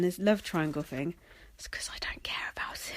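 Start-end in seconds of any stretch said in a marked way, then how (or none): 1.6-2.9 a conspiratorial whisper; whispered aside. Real, not acted. Admitting to listeners she doesn't care, whispered. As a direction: drop to a whisper like a secret.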